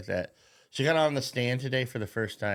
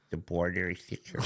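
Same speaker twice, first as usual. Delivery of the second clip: softer, flat mock official drone; mocking Mayorkas; 4 semitones lower, 6 dB quieter than usual. Mocking the border chief's stock answer, softer drone. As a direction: mumble it like a smug bureaucrat. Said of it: "That's exactly how he'd fucking sound".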